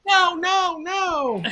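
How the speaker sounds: high mock yell of protest; retold scream. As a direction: cry out no at the screen. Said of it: "screaming, punching my desk".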